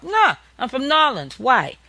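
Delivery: put-on New Orleans accent. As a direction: answer back in a thick New Orleans accent. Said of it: "they think".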